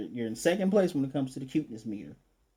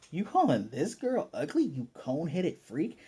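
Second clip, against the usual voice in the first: indignant telling-off; 3 semitones higher than usual. Pretend. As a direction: take mock offence on her behalf. Narrator indignantly tells off the bully.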